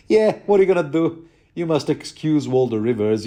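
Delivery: hammed-up polite excuse, brushing it off fast; polite shrugging Lothar. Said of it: "Lothar is all polite and stuff".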